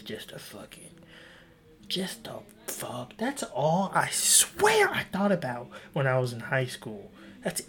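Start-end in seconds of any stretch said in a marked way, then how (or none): 0.0-3.2 slow whispered punchline; conspiratorial whispered aside. Real, not acted. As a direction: drop to a hushed slow whisper. Hushed punchline of question.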